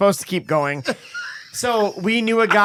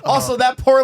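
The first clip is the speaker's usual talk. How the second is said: ordinary talk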